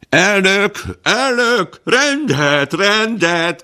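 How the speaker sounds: high, loud calls for order; crowd shouting. Acted. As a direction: shout over the uproar of the hall. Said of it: "kiáltották többen".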